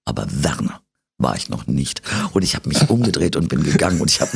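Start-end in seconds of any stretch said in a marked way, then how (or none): 0.1-2.0 deadpan, low and dry; flat dry retort, pitched low; bone-dry. Retold or imitated. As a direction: deliver the put-down totally dry. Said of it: "ich war so knochentrocken".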